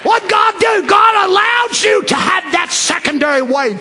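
very high, shouted proclamation; full-force preaching. Real, not acted. Preacher tells congregation what God allowed, shouting. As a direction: cry it out from the pulpit.

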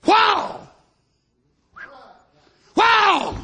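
high, loud shout of awe; excited exclamation. Real, not acted. Preacher marvelling before the congregation, shouting wow. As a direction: cry out in amazement.

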